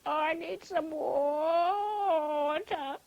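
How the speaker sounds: very slow, drawn-out groaning rooster; poor old rooster. A narrator's reading. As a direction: moan it out, weak with thirst. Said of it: "said poor old rooster".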